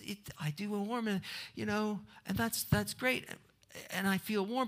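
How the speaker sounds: a small bird surprised by comfort; lightly voiced birdie, cosy; contented birdie. Birdie delighted by warmth.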